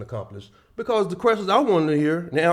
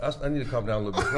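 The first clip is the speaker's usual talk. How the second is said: usual speaking voice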